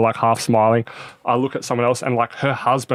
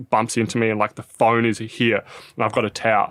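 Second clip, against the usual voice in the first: normal talking voice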